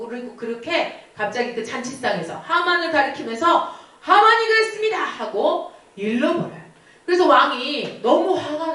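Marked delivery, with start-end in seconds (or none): 4.0-5.0 high, pointed accusation; queen denouncing Haman, pitched high; accusing queen. Acted. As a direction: point him out, voice leaping up. Esther tells on Haman.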